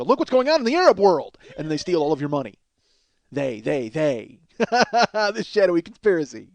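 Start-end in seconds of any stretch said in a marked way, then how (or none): 0.0-1.4 mock official. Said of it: "and go".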